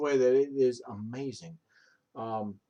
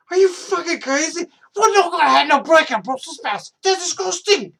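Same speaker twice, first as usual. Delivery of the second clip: much higher, much louder mock yell; hammed-up outrage, yelling in a put-on voice; a hammed-up furious shout refusing the sprouts; mock outraged yell; 11 dB louder than usual. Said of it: "I'm like".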